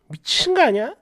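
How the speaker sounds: plain reading voice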